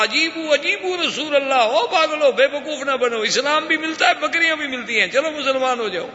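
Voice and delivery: high, eager call to the tribe; high-pitched, thrilled shouting to the clan; excited calling out. Acted. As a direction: rouse your people, you've found a treasure. Bedouin calls out to his tribe.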